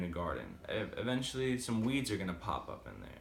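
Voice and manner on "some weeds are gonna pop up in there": usual speaking voice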